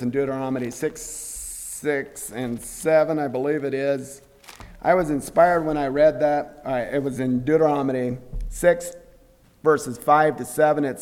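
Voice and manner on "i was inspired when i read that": ordinary talk, nothing marked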